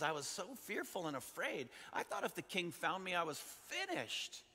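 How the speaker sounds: high-pitched, animated amazement; astonished disbelief. Acted. Acting out Mephibosheth's astonished relief.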